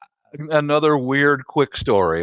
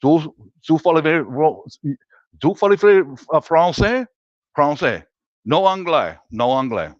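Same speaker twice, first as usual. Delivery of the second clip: fake French; 3 semitones higher than usual. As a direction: put on a hammy French accent. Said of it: "pretended like I didn't speak English".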